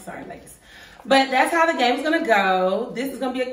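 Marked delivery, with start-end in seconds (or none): 0.0-0.6 whispered quick apology. Real, not acted.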